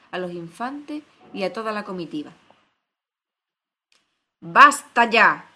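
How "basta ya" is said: very loud, drawn-out shout; explosive, slow command to stop. A narrator's reading. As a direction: bellow it like an enraged queen. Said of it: "le gritó la reina".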